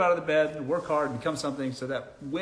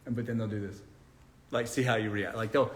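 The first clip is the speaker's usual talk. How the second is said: usual speaking voice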